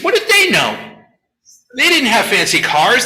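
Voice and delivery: mock dismissive critic. Modern critic mocking ancient sages, sneering. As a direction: scoff at the ancients. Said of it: "the modern critique is".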